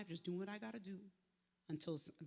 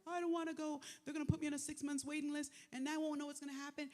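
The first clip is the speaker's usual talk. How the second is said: higher, fast, panicky pleading; retold panic; 7 semitones higher, faster than usual. Young her refusing her friend's hospital advice, panicked and fast. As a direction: replay the frightened refusal in a rush. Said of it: "I was panicking even more".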